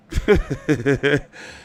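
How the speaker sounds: amused chuckling; a short, loud run of chuckles. Real, not acted. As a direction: laugh at your own story. Amused by his own retelling.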